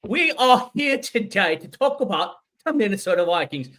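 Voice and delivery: loud, high-pitched mock British lordly welcome; British accent. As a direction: play a pompous British lord introducing guests. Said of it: "have gone full British".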